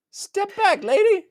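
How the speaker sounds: very high-pitched mock tough guy; Whiskey Jack. Defender warning a lady to back off, mock confrontational. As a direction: square up in a comically high voice.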